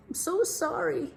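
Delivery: high, lightly voiced crocodile; crocodile apology. The crocodile apologizing to Big Max, lightly voiced. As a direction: whine the apology a little. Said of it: "A big tear rolled".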